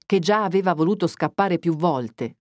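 usual speaking voice

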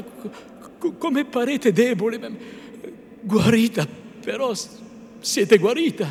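urgent, stammering tenderness; agitated concern. A narrator's reading. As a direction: stumble over words with anxious relief. Renzo anxious over pale Lucia.

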